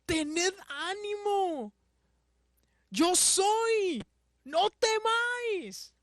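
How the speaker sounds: high, slow proclamation of reassurance; Jesus. Acted. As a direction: call out commandingly across the water. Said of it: "Jesús les habló diciendo".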